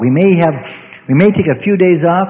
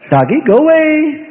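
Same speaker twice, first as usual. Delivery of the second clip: mock timid plea; 9 semitones higher than usual. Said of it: "and say".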